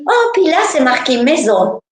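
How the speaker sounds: put-on a child relieved to be going home; happy little girl. Little girl telling the husband Jesus takes her home, excited child voice. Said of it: "Puis je dis".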